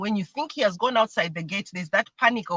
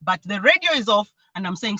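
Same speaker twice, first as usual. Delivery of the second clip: ordinary talk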